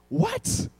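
high, sudden squeak of disbelief; shocked yelp. Retold or imitated. Husband finds out the team's flight was diverted, shocked. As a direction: blurt it out, startled, pitch shooting way up.

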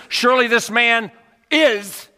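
"is" held up at the end; stress demo. Shown as a demonstration. Preacher trying a wrong emphasis pattern. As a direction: stress "surely" then "is". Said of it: "nor was the emphasis".

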